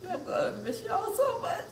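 high, squeaky crying voice; real tears squeezing the words. Real, not acted. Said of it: "these tears are".

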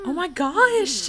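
high, loud, drawn-out squeal; shocked shriek. Real, not acted. Gamer squealing at her Sims in the shower.

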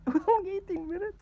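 loud, high and fast; excited. Real, not acted. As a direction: blurt it out in amazement. Amazed at duration.

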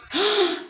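very high sharp gasp. Real, not acted. Kid gasps at something in the game. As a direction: squeak of sudden surprise. Sudden surprise mid-sentence.

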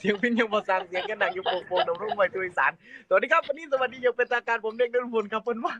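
laughing, mock mor lam stage greeting in Isan accent; old stage Isan accent. Singer reenacts his early stage Isan accent speech, laughing. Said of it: "พี่ขึ้นเวทีพี่พูดสำเนียงนี้เว้ย".

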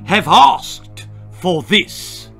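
slow, loud, high threat; furious military warning; angry sergeant. Acted. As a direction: draw out each word menacingly. Sergeant turkey threatens the boy.

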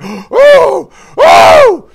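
high, loud shocked yelp rising into a cry; panicked gasp at a crashing price. Retold or imitated.

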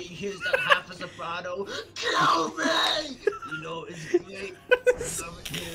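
high, crying mock scream cut in half; hammed-up agony. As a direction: overact the pain of being sliced in two. Half a Soprano voiced dying.

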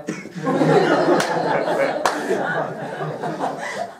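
airless giggle rising; wheezy silent laughter; breathy laugh. Real, not acted. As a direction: laugh at how you slipped away. Laughing at his quick exit.

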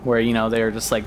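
ordinary talk, nothing marked

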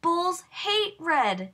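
high, slow drawn-out wail. A narrator's reading. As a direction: stretch it out in despair. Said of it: "she wailed".

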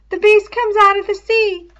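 put-on high, eerie little boy; high child voice. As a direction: whisper a child's fear aloud.

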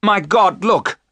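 high, loud cry of alarm; terrible cry. A narrator's reading. Jonathan spots the Count in London, crying out. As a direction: cry out in sudden horror. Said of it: "gave a terrible cry".

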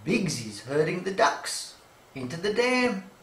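ordinary delivery

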